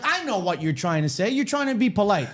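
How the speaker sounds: high insistent mock voice; imitating the customer. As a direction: push the waiter to say his name. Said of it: "He was like".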